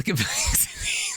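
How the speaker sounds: whispered through stifled giggles; trying not to laugh; suppressed laughter. Real, not acted. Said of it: "laughs, I can't do it".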